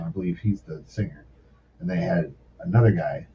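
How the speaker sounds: ordinary talk, nothing marked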